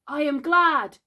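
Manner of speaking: high, loud relief; glad cry. A narrator's reading. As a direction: cry out gladly. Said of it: "Peter cried".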